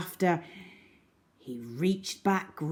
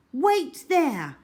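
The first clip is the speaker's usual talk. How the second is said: higher call to stay put; boy calling back as he runs; 9 semitones higher, 4 dB louder than usual. A narrator's reading. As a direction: tell her to wait, calling. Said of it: "cried Algy".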